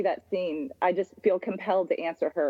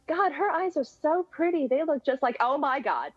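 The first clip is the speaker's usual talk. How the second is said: higher-pitched, wondering reaction; retold dawning amazement; 6 semitones higher than usual. Fan retelling the moment she recognized Simon's eyes, retold dawning amazement. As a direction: relive the moment it dawns on you.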